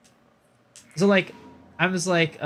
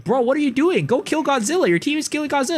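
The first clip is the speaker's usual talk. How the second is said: exasperated yell; 10 semitones higher, faster than usual. Retold or imitated. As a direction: yell at him to go fight. Frustrated at kid fleeing fights.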